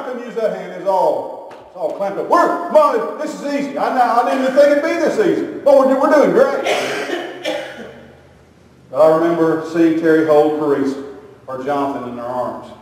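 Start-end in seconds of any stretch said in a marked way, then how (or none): 2.3-7.2 high, squeezed fake ease; mock pained reassurance. Husband telling Mom it's easy, high mock-pained voice. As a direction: pretend it's easy while your hand is crushed.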